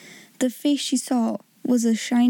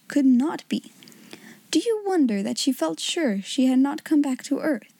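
ordinary delivery, nothing marked

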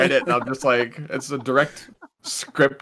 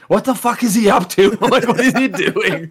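baffled; 10 semitones higher, 6 dB louder than usual. Retold or imitated. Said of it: "And I'm like".

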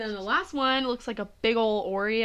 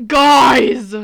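shouting out; 4 semitones higher, 16 dB louder than usual. Real, not acted. Calling out to the audience.

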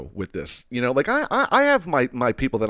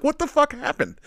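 mock incredulous, pitched way up; hammed-up disbelief; jokingly stunned question, much higher. Asking about the injuries.

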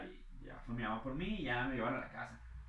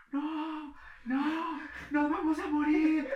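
high, loud panicked crying, slower; screaming girls; 13 semitones higher, 8 dB louder, much slower than usual. Retold or imitated. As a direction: wail it like terrified little girls. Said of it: "había niñas gritando".

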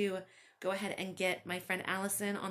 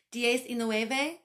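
put-on slow, louder Spanish-accented call; a server reading the number in Spanish; 5 semitones higher, 6 dB louder, much slower than usual. A narrator's reading. Woman calls order number in Spanish.

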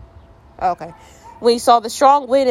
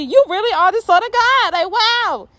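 very high, over-the-top amazement; comic high-pitched astonishment; awed exclamation; 11 semitones higher than usual. Acted. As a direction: gush in mock wonder. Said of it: "They exclaimed".

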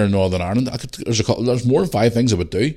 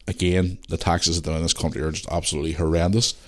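ordinary talk, nothing marked

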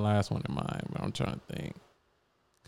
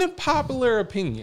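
announcing; 12 semitones higher than usual. Acted. Repeats hot take announcement.

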